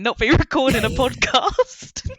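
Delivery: laughing. Real, not acted. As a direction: laugh through the end of the joke. Joking about Essex market jobs.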